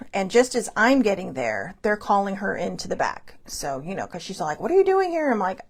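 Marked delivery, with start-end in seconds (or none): 4.6-5.3 surprised. Retold or imitated. Sister surprised to see her.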